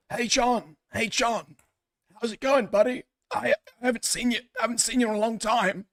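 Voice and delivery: a sunny dad hugging his estranged son; cheery dad greeting. Retold or imitated. Father greeting his son at a reunion, lightly voiced and cheery. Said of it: "his dad goes to hug him".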